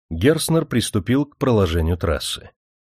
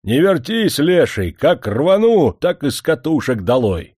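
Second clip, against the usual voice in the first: cheeky shout; 5 semitones higher, 4 dB louder than usual. A narrator's reading. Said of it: "крикнуть".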